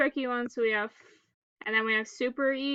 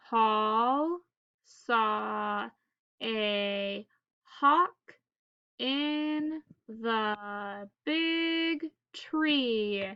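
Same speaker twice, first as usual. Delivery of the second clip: word by word, slowed right down; staccato; 2 semitones lower, much slower than usual. Shown as a demonstration. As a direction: read each word separately, pausing. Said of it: "one word at a time".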